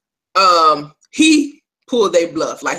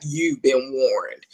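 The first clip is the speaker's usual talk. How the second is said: slow warning; 4 semitones lower, 4 dB quieter, much slower than usual. Retold or imitated. King warning the traitor.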